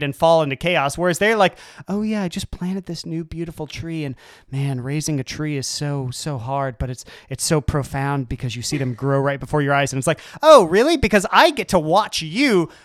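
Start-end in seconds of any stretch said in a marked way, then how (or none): 1.9-9.9 dreamy parent voice. Retold or imitated. 10.4-12.7 louder, slow sarcastic retort; slowly sarcastic, bitter. Retold or imitated. Sarcastic reply to parent.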